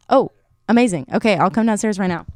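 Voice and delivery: calling out loudly to someone downstairs; yelling across the room. Real, not acted. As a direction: raise the voice to carry through the house. Answering dad calling her downstairs.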